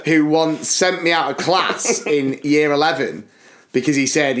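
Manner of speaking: ordinary talk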